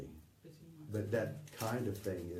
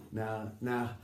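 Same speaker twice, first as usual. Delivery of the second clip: usual speaking voice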